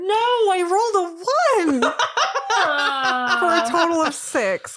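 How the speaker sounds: high, loud laughing groan over a bad roll; laughing despair. Real, not acted. Player laughing in dismay at rolling a one. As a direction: laugh it out in disbelief.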